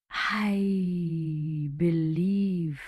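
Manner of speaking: very slow, drawn-out words; halting professor, slowly feeling for it; hesitant. A narrator's reading. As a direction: stretch each word out as if unsure. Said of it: "he hesitated".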